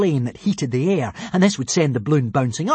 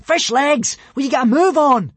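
calling down; 11 semitones higher, 5 dB louder than usual. Acted. Hiccup calls down to Fishlegs.

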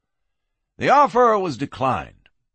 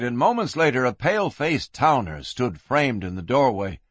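ordinary delivery

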